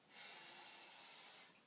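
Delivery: "S-ad" softly stretched sound by sound; segmented slowly. Shown as a demonstration. Teacher segments "sad" while moving the chips, slowly. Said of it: "use the chips as I say the word".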